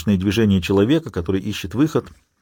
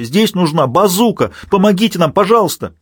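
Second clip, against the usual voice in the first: desperate plea; 8 semitones higher than usual. Acted. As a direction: beg for help in despair. Garcia begs for help.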